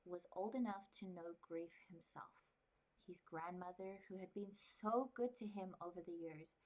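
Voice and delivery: calm, even storybook reading; story narration. Acted. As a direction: read it like a quiet novel. Reads novel aloud.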